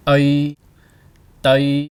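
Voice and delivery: Ây and Tây said loud with a short vowel; teacher modelling ây loudly; loud clear demo. Shown as a demonstration. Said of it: "A with the hat Y".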